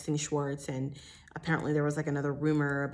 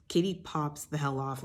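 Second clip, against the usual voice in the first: ordinary talk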